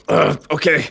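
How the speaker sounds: ordinary delivery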